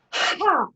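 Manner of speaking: loud high Ha blasted on the breath out; shouted Ha. Shown as a demonstration. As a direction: shout Ha from the belly. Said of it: "The last two as loud as you can".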